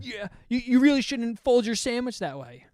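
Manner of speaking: high-pitched mock nagging; worked-up scolding. Old deli worker scolding the twenty-year-old, mock worked-up. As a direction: imitate a fussy coworker getting worked up. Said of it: "they just get so worked up".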